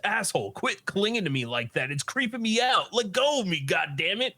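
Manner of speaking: high, agitated anime outburst; irritated protest. Retold or imitated. Bully McGuire to Takakura clinging onto him, irritated. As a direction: protest with pitch climbing, trying to shake someone off. Retold struggle to be let go.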